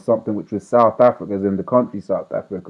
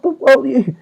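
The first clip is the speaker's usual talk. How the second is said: mock realization; 14 semitones higher, 4 dB louder than usual. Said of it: "I was like".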